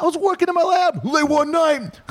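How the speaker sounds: very high-pitched shouty rap parody. Acted. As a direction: yelp it out like a hammed-up rap crew. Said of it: "do it like the Beastie Boys".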